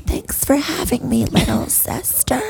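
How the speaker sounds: put-on high, whispery older-sister voice; Olga. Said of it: "Olga from Hey Arnold".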